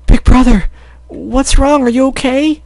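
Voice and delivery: worried little sister. Acted. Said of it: "Cherry ran over to him".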